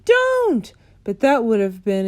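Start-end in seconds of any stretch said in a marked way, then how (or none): 0.1-0.7 much higher, louder cry; stifled scream of don't; inner scream. A narrator's reading. Sophie screaming inside as the giant lifts her, high. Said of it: "Sophie wanted to scream".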